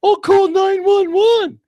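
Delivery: loud, high, drawn-out whine; mocking helpless mom. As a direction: mimic a clueless mom in a squeal. Mocks a helpless single mom.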